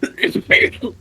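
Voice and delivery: harsh real cough; choking cough; coughing fit. Real, not acted. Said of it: "You okay, King Henry?".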